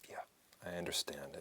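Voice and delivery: soft whispered reply. Real, not acted. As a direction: whisper it back gently.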